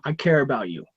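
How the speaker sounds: low, soft and sincere. Real, not acted. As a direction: say it gently, from the heart. Sincere repeat to friend.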